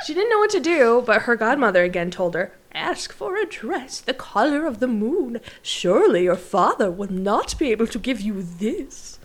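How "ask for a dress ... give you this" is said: put-on old lady voice. Godmother voiced again.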